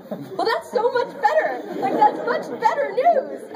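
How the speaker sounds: high-pitched squeal of relief, stretched out at the end; overjoyed relief. Retold or imitated. Retelling her reaction to getting the role, overjoyed squeal. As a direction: gush in a high, half-laughing squeal.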